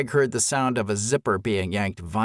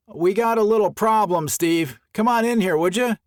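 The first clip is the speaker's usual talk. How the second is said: higher, louder shout to a friend; shouting back; 9 semitones higher, 3 dB louder than usual. A narrator's reading. Greg shouting back to Steve through the brush, loud call. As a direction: raise your voice to reach Steve. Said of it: "shouted back".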